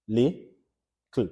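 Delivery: "Li, kl" in two separate beats with a pause; syllable by syllable. Shown as a demonstration. Teacher counting syllables for students, "Li, kl" split apart. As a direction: clap out "Li" then "kl". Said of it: "Clap it out".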